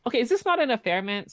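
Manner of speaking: indignant disbelief. Real, not acted. Indignant about friend's affair.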